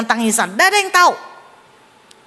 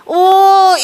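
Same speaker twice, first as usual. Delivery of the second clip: excited oh; 8 semitones higher, 8 dB louder than usual. Real, not acted. Preacher exclaims over husband's change.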